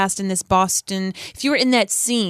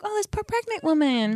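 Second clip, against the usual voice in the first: high, soft quoted pity; imagined sympathetic neighbor; 7 semitones higher, 4 dB quieter than usual. Retold or imitated. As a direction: lightly voice a pitying neighbor. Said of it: "she probably was like".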